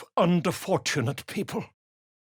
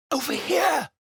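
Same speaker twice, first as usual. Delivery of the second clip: calling out; 10 semitones higher than usual. Acted. Author hailing companions from afar, high call. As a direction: wave them over with your voice.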